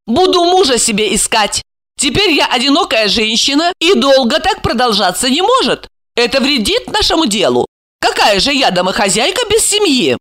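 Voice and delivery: high-pitched resolute female character; determined woman. Acted. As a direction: argue your plan in a lifted voice. Voices Alexandra's decision.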